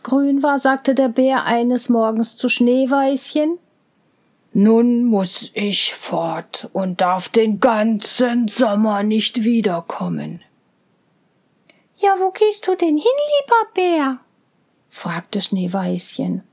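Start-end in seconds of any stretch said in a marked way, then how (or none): 4.5-10.4 a bear saying goodbye reluctantly; sad farewell bear. Acted. 12.0-14.2 put-on high, quick girl's voice; a little girl anxiously asking; young girl. Girl asks the bear.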